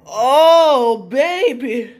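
loud, high drawn-out moan of delight; savouring a sandwich, loud ecstatic moan; pleasure moan. Real, not acted. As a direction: moan over the first bite. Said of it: "oh, this is orgasmic".

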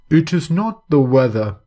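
flat dry reply; cold dry correction; dryly. A narrator's reading. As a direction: say it dry and unmoved. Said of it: "Mister Siggs replied dryly".

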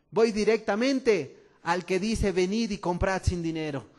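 rising, loud preaching build; fervent preacher building up; full-force preaching. Acted.

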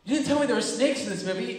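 high, quick mock protest; rushed, upset complaint; indignant accusation. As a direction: accuse like a frightened brother. Scared brother accuses teller.

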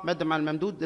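normal talking voice

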